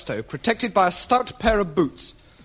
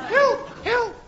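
high cry for help. Acted. After falling down stairs.

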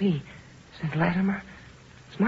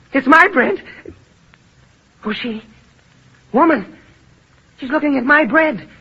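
higher and louder, clutching at his bread; frantic possessiveness; 4 semitones higher, 6 dB louder than usual. Acted. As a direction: grab it before anyone else does. Starving man guarding bread.

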